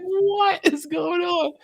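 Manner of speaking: laughing disbelief. Retold or imitated. Retold amazement while laughing.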